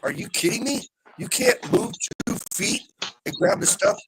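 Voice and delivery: incredulous retort. Retold or imitated. Customer retelling the row with the store clerk, incredulous. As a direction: throw the challenge back in disbelief.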